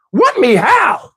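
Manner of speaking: high, drawn-out comic question; amazed reply. Acted. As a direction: pitch up in slow disbelief. His amazed reply to her.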